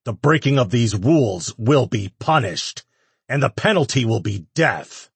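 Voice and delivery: loud, high stern decree. A narrator's reading. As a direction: pronounce the sentence to a crowd. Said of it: "Ash continued".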